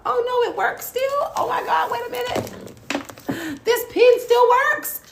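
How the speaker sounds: very high, delighted shriek of surprise; excited squeal. Real, not acted. Vlogger finding that the pen still works, excited squeal. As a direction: squeal it, stretching the last words.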